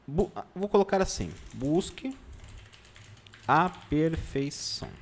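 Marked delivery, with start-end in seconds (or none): 1.5-4.9 slow, word-by-word dictation; dictating while writing. Real, not acted. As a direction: spell it out slowly as you write, with gaps.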